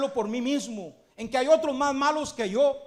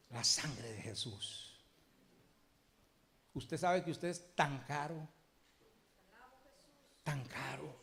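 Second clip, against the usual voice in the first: a softer, lower, intimate preaching voice, leaning in close; intimate sermon; 4 semitones lower, 5 dB quieter than usual. Acted. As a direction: speak softly of the soul's worth. Preacher turns soft and intimate. (predicación suave e íntima)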